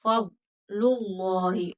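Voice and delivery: फज़लुल्लाहि slow, heavy dark lam in Allah; फज़लुल्लाहि with a full, thick lam; tajweed demo. Shown as a demonstration. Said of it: "अल्लाह का लाम पुर मोटा करके".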